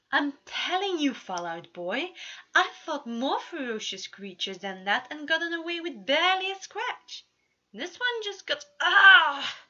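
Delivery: bragging loud and high, then breaks into a yelp; boastful, rising to a pained cry. Acted. Prince bragging to his companion, then a sharp cry of pain. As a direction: show off, then get cut off by the pain.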